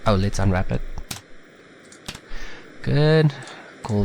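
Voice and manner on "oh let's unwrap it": usual speaking voice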